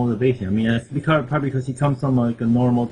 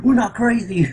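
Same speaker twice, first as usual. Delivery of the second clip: mock panicked denial; 8 semitones higher, 4 dB louder than usual. Joining the acted denial.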